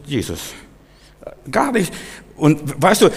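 usual speaking voice

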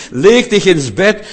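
Leg dich ins Bett, high, loud and slow; loud emphatic repeat. Shown as a demonstration. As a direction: call it out to the room. Repeated loudly for the congregation.

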